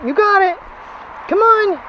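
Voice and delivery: very high, loud coaxing. Real, not acted. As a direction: call him on brightly. Coaxing dog.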